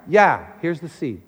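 God's easy, settled response; calm reply. Acted. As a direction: brush off the plea gently.

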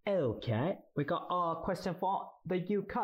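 high-pitched, badly faked English accent. Friend improvising a funny question, bad English accent. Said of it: "I'm really bad at an English accent".

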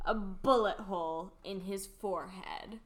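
ordinary delivery

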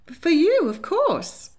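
put-on a flustered older lady clutching her pearls; fluttery Lady Hartwood. A narrator's reading. Lady Hartwood scolding her granddaughter, high and fluttery.

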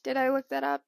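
ordinary talk, nothing marked

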